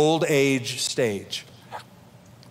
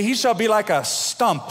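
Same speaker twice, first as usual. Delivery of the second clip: "stump" punched after a raised lead-in; higher pitch, landing hard on "stump"; stress on stump; 6 semitones higher than usual. Shown as a demonstration. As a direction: hit the word "stump" in contrast. Contrasts stump with tree.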